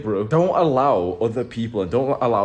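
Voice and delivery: usual speaking voice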